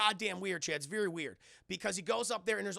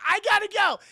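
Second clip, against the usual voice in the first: high, very loud blurt; mock hurried shout; 14 semitones higher, 15 dB louder than usual. Desiree fleeing after the kiss, high loud blurt. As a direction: blurt it out as an abrupt exit.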